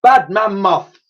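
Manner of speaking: loud, high-pitched scolding; chiding. A narrator's reading. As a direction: tell off a naughty animal.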